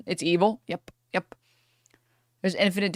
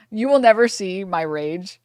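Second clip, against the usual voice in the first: ordinary delivery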